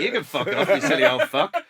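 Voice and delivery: loud comic comeback to a heckler; retold retort. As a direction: throw the insult straight back, half laughing. Hurls insult back at old heckler.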